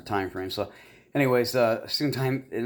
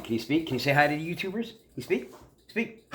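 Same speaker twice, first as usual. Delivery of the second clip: rising, higher encouraging coax; coaxing the dog; 6 semitones higher than usual. Real, not acted. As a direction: coax the dog to bark, voice climbing.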